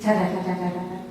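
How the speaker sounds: plain reading voice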